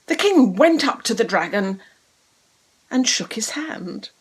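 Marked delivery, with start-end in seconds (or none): none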